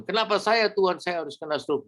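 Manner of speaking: "Kenapa saya" jumps high, a mock whine at God; mock complaint. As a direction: pitch the complaint high as a parody. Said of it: "Bukan saya bilang".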